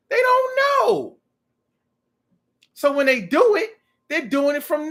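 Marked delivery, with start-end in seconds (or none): 0.1-1.2 much higher and louder, slow, shouting DONT KNOW; shouted emphasis. Real, not acted. Speaker shouting the point at viewers, slow and loud. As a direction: bellow it so they finally get it.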